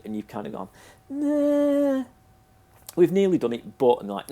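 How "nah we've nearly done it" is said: high, loud mocking whine; mock the lazy developer, squeaky and loud; whiny developer voice. Mocking imagined developer reply.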